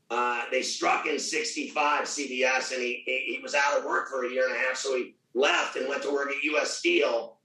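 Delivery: normal talking voice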